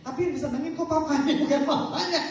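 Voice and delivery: ordinary talk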